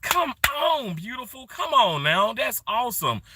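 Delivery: high, hyped-up cheering; excited cheering. Real, not acted. Streamer cheering a viewer on, hyped and high. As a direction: cheer them on like a hype man. Hyping someone up at stream start.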